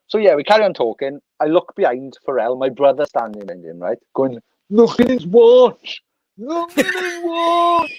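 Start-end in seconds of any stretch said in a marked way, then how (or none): 4.7-7.9 high, drawn-out comic shout; mock amazed cry. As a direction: imitate a gawper, stretched out and climbing higher. Brother's gawping cry behind [name], imitated.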